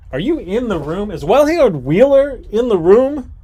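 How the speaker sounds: high, loud summons; host shouting for a missing player; calling out. Real, not acted. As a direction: call into the room for them. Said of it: "calling out a player".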